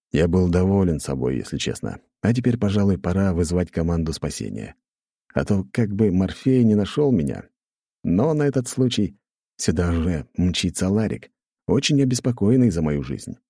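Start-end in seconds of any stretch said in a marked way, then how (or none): none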